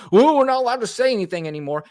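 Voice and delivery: high, quick imitation of a complainer; mock complaint. Host imitates people who claim they are silenced, mock complaint. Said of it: "all of a sudden you're like".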